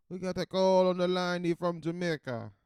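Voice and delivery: loud, high hammed-up Jamaican accent. Podcaster announcing a caller on the line, booming mock Jamaican accent. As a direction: shout it out in a broad Jamaican accent.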